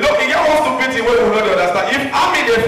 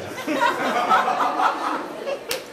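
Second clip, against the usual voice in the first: high breathless laugh; 7 dB quieter than usual. Real, not acted. Laughing at his beating.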